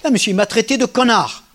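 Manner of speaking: high, loud indignant pupil; angry student. Retold or imitated. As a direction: protest an insult to the teacher. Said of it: "angry student".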